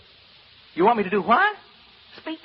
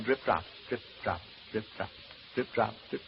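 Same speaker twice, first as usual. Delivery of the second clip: hushed counting; 6 semitones lower, 8 dB quieter than usual. Acted.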